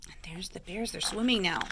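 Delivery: hushed whisper; soft, voiceless aside. Real, not acted. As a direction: quietly point it out in a whisper. Soft aside during the reading.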